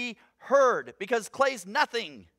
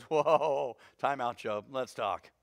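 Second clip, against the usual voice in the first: low, unhurried interruption; God; 8 semitones lower, slower than usual. Acted. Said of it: "God finally goes".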